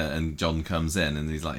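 ordinary delivery

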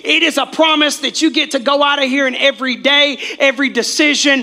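loud, high, fervent preaching. Acted. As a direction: build it like a sermon climax. Preaching climax at full force.

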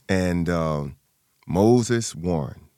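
usual speaking voice

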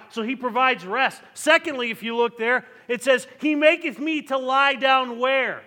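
booming sermon delivery climbing in pitch; full-force preaching. Acted. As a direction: preach it to the back pews. Pastor preaching the psalm loudly.

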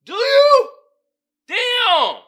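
hammed-up shock at the viewers; mock exclaim.

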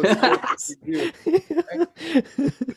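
high, laughing through the words; amused, cracking up. Real, not acted. Mocking the big reveal while laughing.

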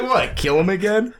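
high-pitched incredulous question, amused; mock incredulity. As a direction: repeat the punchline as a high, mock-baffled question. Replaying Connery's punchline amid laughter.

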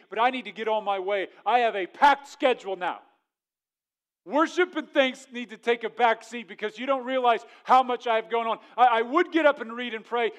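loud, hurried brush-off voice; busy ungrateful leper. Acted. Preacher voicing the nine who are too busy to thank Jesus, loud and rushed. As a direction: blurt out excuses for skipping worship.

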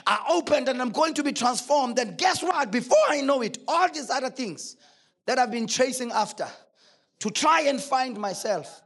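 loud, driving sermon delivery; full-force preaching. Acted. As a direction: preach it out, building point on point.

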